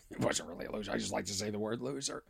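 low, very soft aside; hushed, low admission under the main story; quiet aside. Real, not acted. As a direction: drop the voice right down, almost to yourself. Confessional aside, voice drops low.

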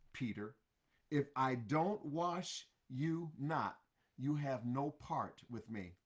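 normal talking voice

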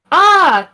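sudden high loud cry of alarm; a startled yell from someone running. Acted. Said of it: "Jeremy heard a yell".

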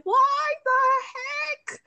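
very high, loud, drawn-out protest; hammed-up high incredulity; exasperated disbelief. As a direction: squeal it in slow outrage. Acting out outrage at scolding.

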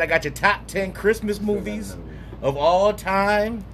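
drawn-out show host announce; podcast announcer. Acted. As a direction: stretch it like a countdown host.

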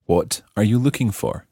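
ordinary talk, nothing marked

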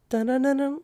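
high sung dun dun of a soundbite; hummed beat imitation. Describing a short album intro to a friend, high hummed dun dun. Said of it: "but it's just like".

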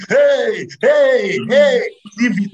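high, punchy "hey" calls; calling out. Acted. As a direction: cry out to cut it short. Calling out to stop the confusion.

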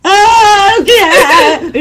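high, drawn-out whiny "what"; mock tearful wail. Crying searcher answering a stranger's tap, mock wail. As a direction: wail it slowly, starting high.

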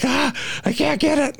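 reaching for the tail and it keeps slipping; frantic strain. Retold or imitated. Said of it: "and I'm like".

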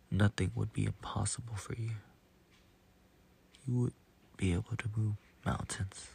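soft, breathy whisper of a promise; hushed whisper. A narrator's reading. Jesus tells his disciples what faith can do, whispered. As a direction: let it drop to a near-silent whisper.

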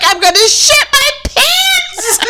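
high, drawn-out wail through laughter; desperate wail. Retold or imitated. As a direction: wail it in mock despair, cracking up.

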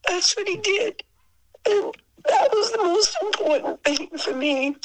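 high, tearful laugh in the voice; real emotional, tearful gratitude; choked up. Real, not acted. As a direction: say it high, moved to tears. Moved by what Frank gave her.

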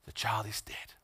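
low, soft statement of acceptance; calm resigned acceptance; David softly accepting his child is dead; quiet acceptance. Retold or imitated. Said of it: "he said".

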